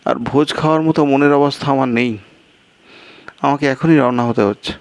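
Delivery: the guest panics about his brother's wrath; agitated guest. Acted.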